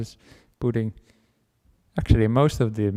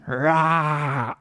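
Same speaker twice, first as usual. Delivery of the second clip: dog snarl; 3 semitones higher than usual. Acted. Dog snarls with big teeth.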